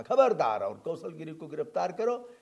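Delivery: loud commanding warning, demanding an arrest; loud retold demand to arrest; retold stern order. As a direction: thunder the order again as he gave it. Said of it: "हमने कहा".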